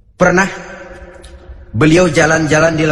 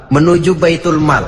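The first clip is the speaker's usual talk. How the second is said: usual speaking voice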